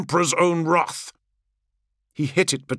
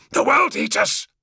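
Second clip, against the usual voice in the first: higher, strained urgent warning from a wounded leader; hissed warning; 7 semitones higher than usual. Acted. Primarch warning his brothers off, strained urgent hiss. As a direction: force it out through the strain like a wounded commander. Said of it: "hissed the Primarch".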